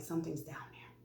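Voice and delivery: quick hushed confession; barely audible, retold whisper; embarrassed whisper. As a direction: whisper it so nobody else hears. Embarrassed confiding to fire crew.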